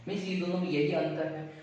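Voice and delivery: ordinary delivery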